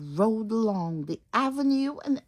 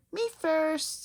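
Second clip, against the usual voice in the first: proud limo; 10 semitones higher than usual. Acted. Proud limo pushing ahead.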